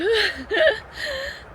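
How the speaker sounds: high, squeaky giggle; helpless little high-pitched giggle; amused laugh. Real, not acted. Laughing at her slow dogs.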